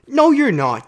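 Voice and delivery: loud high retort; emphatic no. Real, not acted. Coach shutting down praise for depression, loud retort. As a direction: cut them off, hard denial.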